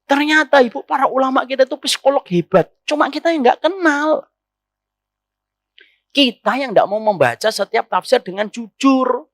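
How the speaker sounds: ordinary delivery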